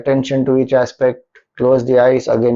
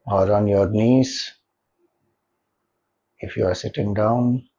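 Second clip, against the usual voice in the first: soft, lower meditation voice with long pauses; guided meditation; 4 semitones lower, 6 dB quieter than usual. Acted. Leader settling listeners into a comfortable posture and gratitude, guided meditation. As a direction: guide them gently into stillness, leaving long silences between phrases.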